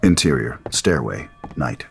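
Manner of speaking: usual speaking voice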